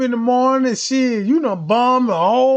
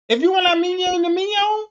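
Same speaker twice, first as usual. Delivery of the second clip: much higher mock cashier; 7 semitones higher, faster than usual. Mock cashier pushing mignon at McDonald's, much higher voice. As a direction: pitch way up and ask brightly.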